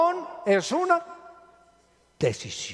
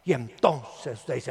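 ordinary delivery

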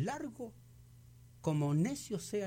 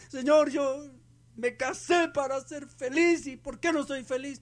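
complaining wife; 10 semitones higher, 5 dB louder than usual. Retold or imitated. Preacher voicing unhappy wife praying.

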